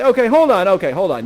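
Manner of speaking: loud, halting interjection; urgent interruption. Acted. Father stops an eager suitor, loud and urgent. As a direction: cut in loudly to stop him.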